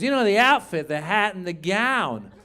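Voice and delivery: imitated club owner stretching out the words; Mitzi. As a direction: draw it out like an insistent older woman. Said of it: "That's how she would talk".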